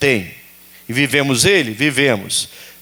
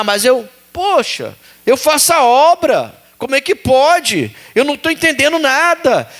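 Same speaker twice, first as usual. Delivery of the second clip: higher, exasperated church member complaining; indignant believer; 7 semitones higher than usual. Retold or imitated. Preacher voicing a frustrated believer, indignant and pleading. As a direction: act the faithful one who feels wronged.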